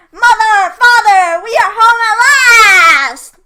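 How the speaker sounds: high, loud calling out to their parents; joyful shout. A narrator's reading. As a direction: shout with joy as you run home. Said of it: "they shouted joyfully".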